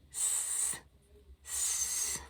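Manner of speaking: long whispered hiss; snake hiss. Pretend. Librarian imitating a snake for kids, hissing. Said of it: "It goes s".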